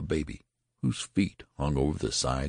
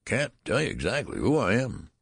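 put-on higher, whining old man voice; read-aloud querulous old man whine; 7 semitones higher than usual. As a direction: complain like a fussy old man in a crib. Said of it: "replied the querulous whine".

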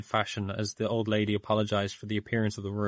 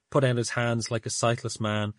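plain reading voice

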